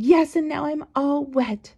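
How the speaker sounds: high, put-upon imitation; mock complaint. Squirted babysitter complaining to the mother, mock aggrieved.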